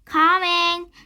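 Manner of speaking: high, loud, sustained call back; shouted reply. A narrator's reading. Annie answers mom from the treehouse window, shouting. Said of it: "Annie shouted out".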